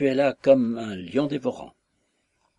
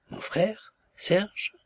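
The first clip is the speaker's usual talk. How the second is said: startled disbelief; 9 semitones higher, much slower than usual. Acted. Wife hears brother arrested.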